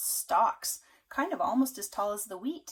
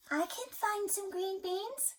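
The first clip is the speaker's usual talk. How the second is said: high, slow duck voice; a lightly quacky duck offering help; 10 semitones higher, slower than usual. Acted. Parent reading to child, a high duck voice.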